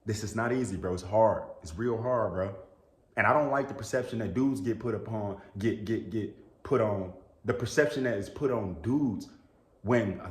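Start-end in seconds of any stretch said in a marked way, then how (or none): none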